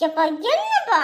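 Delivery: whiny protest. Acted. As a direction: whine at dad for saying no. Child protesting father's refusal. (சிணுங்கலான கெஞ்சல்)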